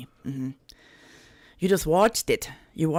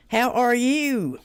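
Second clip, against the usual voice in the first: higher, louder, drawn-out x0.5 sing-song; playful drawn-out hello to chat; sing-song greeting; 6 semitones higher, 4 dB louder, much slower than usual. Pretend. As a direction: stretch the greeting out like a tune.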